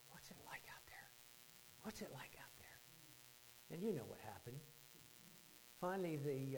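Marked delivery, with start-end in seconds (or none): 0.1-2.8 whispered, wistful wondering. Retold or imitated. Boy at the water's edge wondering to himself, wondering whisper. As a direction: whisper it softly, lost in curiosity. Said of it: "he's wondering".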